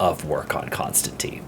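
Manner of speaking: whispered aside; hushed whisper as if the artist might hear. Real, not acted. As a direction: drop to a breathy secret whisper.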